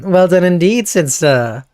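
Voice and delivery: ordinary talk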